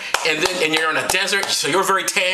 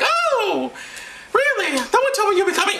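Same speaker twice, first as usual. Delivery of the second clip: very high falsetto woman's voice; high-pitched put-on Mary; comic Mary; 14 semitones higher than usual. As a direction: play her surprised in high falsetto. Acting Mary greeting the wise men.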